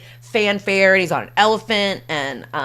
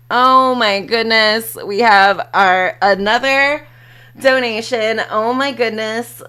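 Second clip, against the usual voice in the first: excited; 4 semitones higher, 6 dB louder than usual. Real, not acted. Thanking donor excitedly.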